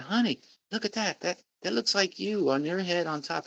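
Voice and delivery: a hint of his wife calling him over; wife's remark. Retelling his wife pointing at the TV, lightly mimicking her.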